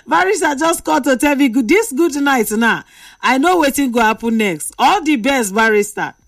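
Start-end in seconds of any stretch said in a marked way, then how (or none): 0.1-2.8 higher, amused retelling. Real, not acted. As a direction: still laughing through the words. Laughing over caller's short call.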